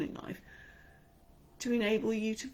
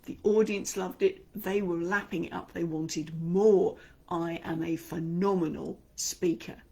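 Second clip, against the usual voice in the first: loud, beaming, triumphant boasting; confident and proud; 7 dB louder than usual. Acted. Coach voicing a confident performer's inner script, loudly proud. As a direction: brag like a star who nailed it. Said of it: "Put yourself in the mindset".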